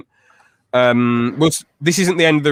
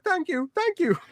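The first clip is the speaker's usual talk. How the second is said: high, softer put-on thanks; mock grateful squeal; 4 dB quieter than usual. Fan imitating YouTuber after being helped, high mock gratitude.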